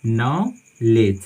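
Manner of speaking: Knowledge drawn out slowly as "nolej", no k, no d; knowledge slowly modelled with the k and d silent; slow model word. Shown as a demonstration. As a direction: say knowledge very slowly so students can copy it. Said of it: "हम इसको पढ़ते हैं knowledge".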